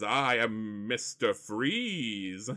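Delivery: slow, stretched-out villain introduction; Mister Freeze. Acted. Villain introduces himself to the heroes, drawn-out and theatrical. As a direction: announce yourself in a drawn-out villain voice. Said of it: "he says".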